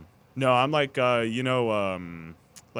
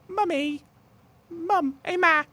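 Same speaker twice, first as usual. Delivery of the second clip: put-on much higher British accent calling mum; high British child; 6 dB louder, slower than usual. Friend keeps up the high British child voice. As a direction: squeak out Mummy in a British child voice. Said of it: "that British accent".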